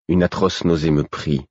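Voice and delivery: usual speaking voice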